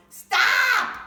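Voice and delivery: very high, very loud shout; yelled. Acted. Tyra stopping the man grabbing her hair, piercing shout. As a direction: scream it to stop him. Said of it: "Tyra yelled very loudly".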